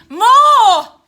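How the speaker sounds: drawn out and belted; screamed-out word. A narrator's reading. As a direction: swell into a mock scream. Narration acts out the increased screaming.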